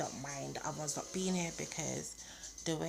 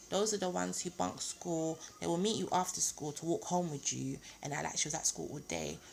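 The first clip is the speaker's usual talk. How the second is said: normal talking voice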